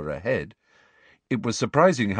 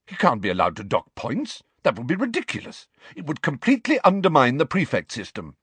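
higher, indignant outrage; appalled; 6 semitones higher than usual. A narrator's reading. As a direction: protest in disbelief. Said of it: "looking appalled".

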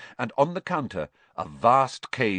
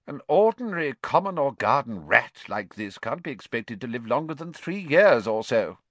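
higher, put-on old witch voice; 3 semitones higher than usual. Shop witch sizes up Ron's rat, in her put-on voice. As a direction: lecture him like a knowing shop witch.

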